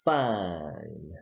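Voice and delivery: Fine, low, with a Southern flattened vowel; southern accent. Shown as a demonstration. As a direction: model Fine in a Southern drawl. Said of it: "so please repeat".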